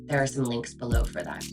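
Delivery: normal talking voice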